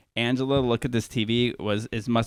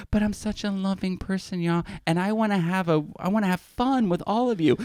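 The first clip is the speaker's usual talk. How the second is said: high-pitched sugary imitation; mock loving contestant; 6 semitones higher than usual. Angela addressing the whole house, mock loving. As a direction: put on a higher, hammed-up sweet voice. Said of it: "then she goes".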